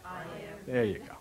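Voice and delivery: whispered answer; hushed reply. Acted. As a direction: breathe it like a revelation.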